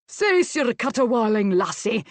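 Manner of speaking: loud, high-starting Scots roar. A narrator's reading. Master silences the weeping maid, roaring. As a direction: bellow the order, easing on the last word. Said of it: "Adam Leslie roared".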